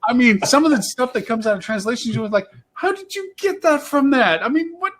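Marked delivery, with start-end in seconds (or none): 2.7-4.9 baffled disbelief. Acted. Host reacting to a garbled translation, baffled. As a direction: demand an explanation, voice way up.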